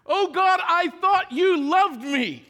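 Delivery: loud, high accusing cry; angry complaint to God. Retold or imitated. Pastor reenacting himself yelling at God in grief, loud. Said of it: "first thing out of my stupid loud mouth".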